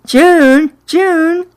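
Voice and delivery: high-pitched calling out of a name; acted yell. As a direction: call out to a friend, pitched well up. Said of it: "I heard Roxy yell".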